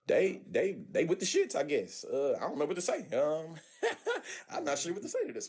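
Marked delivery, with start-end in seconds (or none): 3.8-4.4 a short, high burst of real laughter; a sudden high giggle, caught off guard. Real, not acted. Reacting to the joke.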